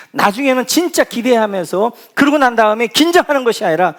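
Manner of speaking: loud, high, driving sermon voice; fervent preaching. Acted. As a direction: preach it out with full force to the congregation. Pastor preaches at full force.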